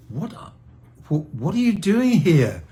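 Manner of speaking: high, astonished exclamation; retold surprise. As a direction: re-enact the amazed question, pitch leaping up. Retelling surprise at daughter's arrival.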